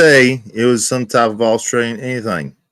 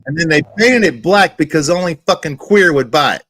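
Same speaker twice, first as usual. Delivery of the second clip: raised, emphatic jab; louder and higher, driving the argument home; heated retort; 5 semitones higher, 4 dB louder than usual. Real, not acted. Heated argument over the hat.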